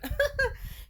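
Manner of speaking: a sudden high burst of laughter; high-pitched cackle. Real, not acted. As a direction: squeal of a laugh. Laughs after roast.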